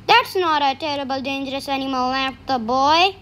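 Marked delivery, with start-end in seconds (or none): none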